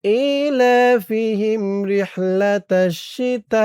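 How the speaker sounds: slow, melodic tajweed chant; Quran recitation. Shown as a demonstration. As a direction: recite slowly like a qari. Recites the verse. (ধীর সুরেলা তিলাওয়াত)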